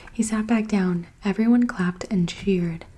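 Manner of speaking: ordinary delivery, nothing marked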